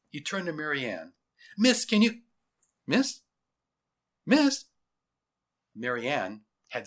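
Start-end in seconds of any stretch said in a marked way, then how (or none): 1.6-4.7 raised voice, climbing with each call; increasingly high, puzzled calls. Acted. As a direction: call after someone who is missing. Officer calls for vanished girl.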